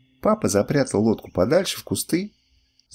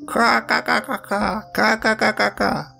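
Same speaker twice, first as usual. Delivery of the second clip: high, drawn-out duck call on a held pitch; кричащая утка; 8 semitones higher, slower than usual. A narrator's reading. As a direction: imitate a duck calling out in rapid repeated syllables, pitched way up. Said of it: "вдруг наша утка закричит". (утиный крик высоким голосом)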